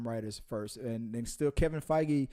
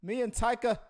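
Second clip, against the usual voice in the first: higher-pitched, badly faked Aussie accent drifting towards Irish; Chris Hemsworth; 4 semitones higher than usual. Co-host role-playing the Thor star praising his director, wobbly Aussie accent. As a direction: have a hesitant go at an Australian accent. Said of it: "No, like, now is that Irish?".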